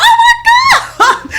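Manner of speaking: very high, loud, slow ecstatic scream with laughter; joyful shout. Retold or imitated. Jumping around the house, ecstatic high shout. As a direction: shriek for joy. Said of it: "I started jumping around the house".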